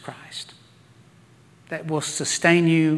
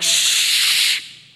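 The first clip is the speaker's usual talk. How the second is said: hushing "shh", drawn out; imitated scolding shush; long loud shush; 3 dB louder than usual. Disciples silence the blind men, long shushing. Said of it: "some of the disciples were like".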